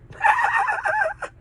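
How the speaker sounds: a sudden, very high, loud cackle; high cackling laugh. Real, not acted. Laughing at his own God joke: high cackling laugh. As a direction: burst out in a wild high laugh. Sudden outburst mid-monologue.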